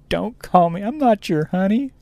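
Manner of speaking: high-pitched mock offended customer; amused, high mockery of someone's outrage; mocking indignation. Mocking the offended customer after laughing.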